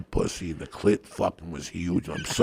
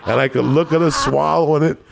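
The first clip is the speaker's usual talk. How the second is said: loud, high-pitched comic rant; worked-up storytelling; 8 semitones higher, 7 dB louder than usual. Real, not acted. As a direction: build the absurd story at full volume. Worked-up climax of his comic story.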